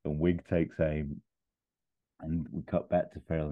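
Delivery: normal talking voice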